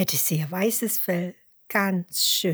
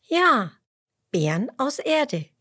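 emphatic yes; 7 semitones higher, 4 dB louder than usual. Acted. Emmerla affirms loudly.

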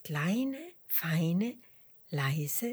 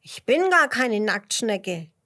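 loud, higher indignant protest; empörte Schnecke; 4 semitones higher, 8 dB louder than usual. A narrator's reading. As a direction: object huffily as a little snail. Snail objects to being misnamed. (laut und empört widersprechend)

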